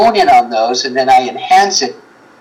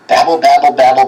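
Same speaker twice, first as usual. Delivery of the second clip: ordinary talk, nothing marked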